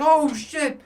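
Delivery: high startled yelp; dad startled by his son. Retold or imitated. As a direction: blurt it out high, caught off guard. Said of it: "And I'm like".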